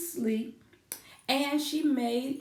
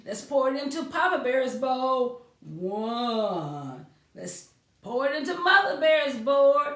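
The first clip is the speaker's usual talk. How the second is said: a hint of a cheerful Mother Bear; lightly voiced mother serving bowls, quick; mildly voiced mama bear counting bowls; 4 semitones higher, 6 dB louder than usual.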